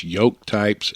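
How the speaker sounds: normal talking voice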